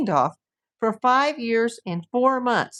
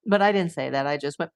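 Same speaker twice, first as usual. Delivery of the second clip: ordinary talk, nothing marked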